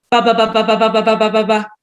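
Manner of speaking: ba repeated as quickly as possible; rapid ba syllables. Shown as a demonstration. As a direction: rattle off ba ba ba on one breath. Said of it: "And so it sounds something like".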